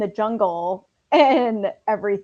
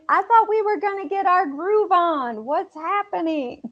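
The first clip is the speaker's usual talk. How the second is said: high, whining mock complaint of a bored girlfriend; lightly voiced whiny girlfriend; 6 semitones higher than usual. Girlfriend complaining at dinner, high mock whine. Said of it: "Trudy's bitching".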